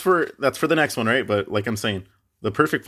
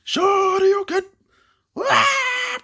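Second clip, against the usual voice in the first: very high anime battle cry; high attack shout, then a scream; acted attack cry; 3 dB louder, much slower than usual. Acting out the gut punch, high battle cry and scream.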